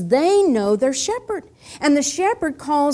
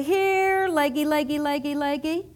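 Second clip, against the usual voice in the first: shepherd calling sheep. Acted. Shepherd calls his little sheep by name, singsong calling. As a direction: coax them in with a lilting, repetitive call. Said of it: "he begins to call his little sheepy".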